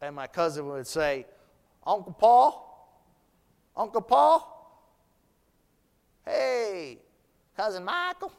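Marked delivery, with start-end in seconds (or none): 1.9-8.3 slow drawn-out Southern Georgia calling, higher; imitating a Georgia cousin's Southern accent. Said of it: "enjoyed my southern accent".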